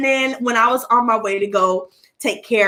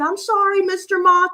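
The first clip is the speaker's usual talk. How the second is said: apologetic; 7 semitones higher than usual. Retold or imitated. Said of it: "I said out loud".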